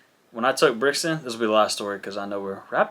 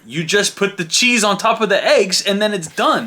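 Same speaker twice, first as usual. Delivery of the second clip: put-on raised, louder child impersonation giving orders; bossy kid; 8 semitones higher, 4 dB louder than usual. Daughter telling dad how eggs are made, bossy mimicked lecture. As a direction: instruct impatiently in a higher kid pitch.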